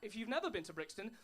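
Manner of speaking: ordinary delivery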